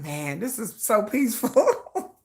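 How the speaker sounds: soft, slow and dreamy; mock peaceful sigh. A fan imagining a peaceful fandom, soft and slow. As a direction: savor the calm like a relieved fan.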